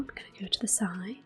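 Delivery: usual speaking voice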